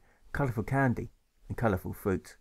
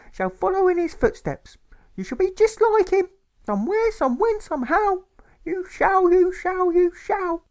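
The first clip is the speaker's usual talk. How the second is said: very high, loud, shrill fervent mother; a grieving mother preaching duty to her son; shrieking mother; 6 dB louder than usual. A narrator's reading. Mother tells her son how his father died, high and loud. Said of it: "shouts, squeals, and shrieks".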